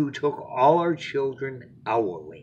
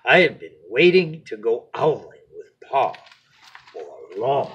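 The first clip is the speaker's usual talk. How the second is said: lively storybook narration; expressive read-aloud to a little one; bedtime story reading; 6 dB louder than usual. A narrator's reading.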